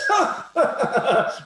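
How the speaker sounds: high, loud burst of laughter; sudden high nervous chuckle. Retold or imitated. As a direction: laugh it off and walk away. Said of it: "And I went".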